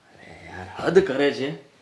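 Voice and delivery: breathy whispered exasperation; exasperated sigh. Acted. Husband alone after the fight, whispered exasperation. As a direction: sigh it out to himself, worn out.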